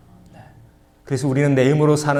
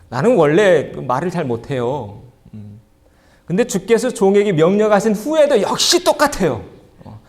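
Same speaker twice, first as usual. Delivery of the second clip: higher, whiny voice of a stubborn refuser; stubborn Moses whining; 6 semitones higher, 4 dB louder than usual. Acted. Pastor voicing Moses refusing God, whiny and higher. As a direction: play Moses digging in his heels, pleading to be left alone.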